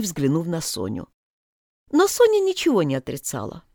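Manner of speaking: usual speaking voice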